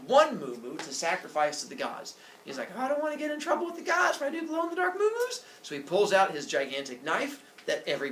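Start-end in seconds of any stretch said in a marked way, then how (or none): 2.8-5.4 put-on high, quick little-kid worry; high, rushed baby talk. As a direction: rattle it off like a toddler. Baby worries about the gods.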